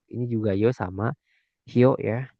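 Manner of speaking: usual speaking voice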